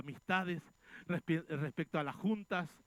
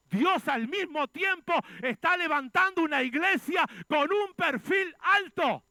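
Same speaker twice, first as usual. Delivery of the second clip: louder, rising pulpit cry, some lines slowed; fiery preaching; 4 semitones higher, 7 dB louder than usual. Acted. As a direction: preach it out to the congregation. Preaching at full force.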